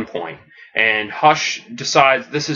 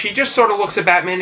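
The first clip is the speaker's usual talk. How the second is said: usual speaking voice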